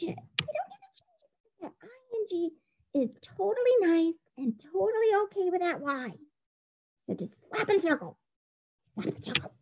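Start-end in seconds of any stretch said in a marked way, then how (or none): 0.0-1.6 high, soft sing-song on don't; sing-song repeat. Shown as a demonstration. Teacher repeating the rule to students, high and sing-song. 8.9-9.5 whispered reminder. Shown as a demonstration. Teacher whispering the marking step to students.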